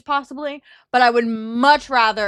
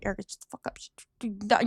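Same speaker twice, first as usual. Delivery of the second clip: quiet, urgent aside; hushed retold scolding; 7 dB quieter than usual. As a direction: shush a friend under your breath. Hushing a loud friend in retelling.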